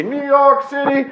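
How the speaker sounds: New York City called out high like an announcer; shout-sing the city name with hype. Pretend. Host hypes the New York guest with a high call-out.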